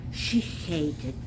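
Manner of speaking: ordinary delivery, nothing marked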